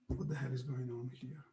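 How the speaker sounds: low, very soft grumble to yourself; muttered aside. Real, not acted. Puzzled aside to himself mid-lecture.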